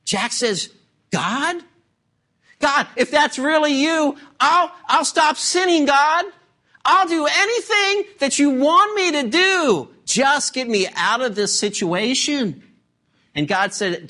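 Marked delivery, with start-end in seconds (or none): 1.1-12.6 high, urgent bargaining for rescue; hammed-up desperate plea; desperate pleading. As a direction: beg and promise anything to be saved. Acting Jack's desperate bargain with God.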